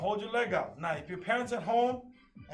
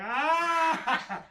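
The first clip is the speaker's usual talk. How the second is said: loud yelp of effort in the stretch; real strained yelp; 8 semitones higher, 6 dB louder than usual. Real, not acted. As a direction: cry out from the strain. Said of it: "he's screaming".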